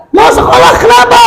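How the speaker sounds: sharp high question; testing mother. Retold or imitated. Mother testing her son, sharp and high. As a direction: press the child for a reason.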